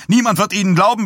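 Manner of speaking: hoher, lauter Wutschrei auf Niemand; wütend schreiend. A narrator's reading. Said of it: "Dies stotterte wütend". (hoch und laut keifend, Betonung auf Niemand)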